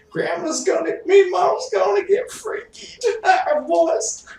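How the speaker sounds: put-on very high, loud falsetto granny calling the boys; high loud old-woman falsetto; old lady voice. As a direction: shriek it like a cheerful old grandma. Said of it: "he sounded like an old woman".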